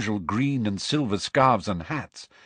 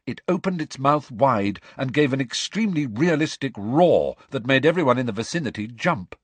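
ordinary delivery, nothing marked

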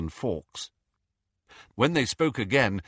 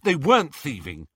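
louder, firm denial; indignant; 5 semitones higher, 5 dB louder than usual. Acted. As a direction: push back hard. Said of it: "said Harry".

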